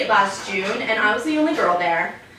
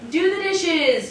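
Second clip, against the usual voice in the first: higher nagging mom voice; nagging mother; 8 semitones higher, slower than usual. Retold or imitated. Comedian mimics her mother's chore request, high nag. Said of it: "unless my mother asks me".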